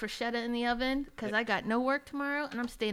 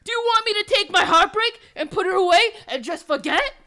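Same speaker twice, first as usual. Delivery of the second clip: high, loud tearful plea; melodramatic anguish; 9 semitones higher, 13 dB louder than usual. Acted. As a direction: wail it like a soap heroine.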